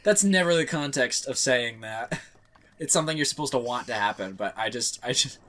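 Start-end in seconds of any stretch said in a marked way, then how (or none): none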